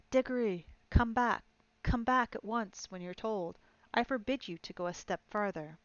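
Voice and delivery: put-on a nervous old uncle shouting from afar; half-hearted shout. A narrator's reading. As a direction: call him back loudly but timidly. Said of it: "shouting, but by no means at the top of his voice".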